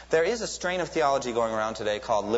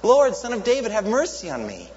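much higher, louder desperate shout; 11 semitones higher, 7 dB louder than usual. Retold or imitated. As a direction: shout over the crowd hushing you. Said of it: "he would shout all the louder".

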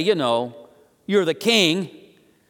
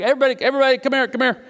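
higher-pitched call to gather people; acted-out summons, beckoning everyone over; 5 semitones higher, much faster than usual. Jesus gathering the crowd, calling out.

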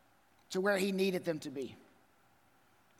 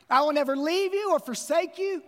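raised high and loud, ringing out; proclaimed promise; 6 semitones higher, 5 dB louder than usual. Retold or imitated. Pastor proclaiming God's promise to the congregation, loud and high. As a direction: declare the promise with full force.

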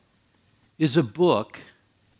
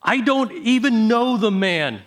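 mildly heated, higher-pitched denial; sworn denial; 7 semitones higher, 4 dB louder than usual. A narrator's reading. Peter denying Jesus to bystanders, mildly heated oath. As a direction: deny it on oath, voice lifted. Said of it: "this time with an oath".

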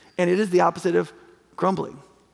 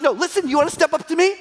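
higher, challenging "listen"; mock confrontation; 6 semitones higher than usual. Preacher voicing Jesus answering the defiant, challenging. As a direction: square up like Jesus facing them.

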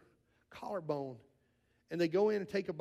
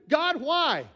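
mock anguished cry to God; 4 semitones higher, 3 dB louder, slower than usual. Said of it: "what do we do naturally".

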